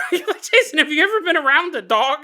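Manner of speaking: laughing. Real, not acted. Laughing at co-host's bark.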